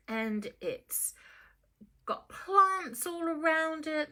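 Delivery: plain reading voice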